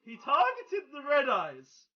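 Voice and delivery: slow, high, loud exclamation falling at the end; incredulous yell. Real, not acted. Player repeating that his Red Eyes got targeted, incredulous yell. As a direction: stretch it out loudly in disbelief. Outraged reaction to opponent's move.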